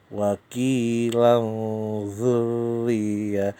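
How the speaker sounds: "Wa qilan dzurriyyah" slow, tanwin hidden into the z with a hum; Quran recitation. Shown as a demonstration. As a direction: recite "Wa qilan" stretched, nasal held before "dzurriyyah". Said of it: "Tanwin ketemu zal". (bacakan "Wa qilan dzurriyyah" pelan, tanwin didengungkan)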